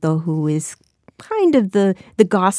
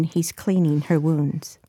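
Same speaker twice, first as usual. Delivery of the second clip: usual speaking voice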